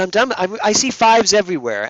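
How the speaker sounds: loud, high-pitched exasperated rant; retold yelling. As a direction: re-enact a fed-up outburst at full voice. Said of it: "why are you yelling at her".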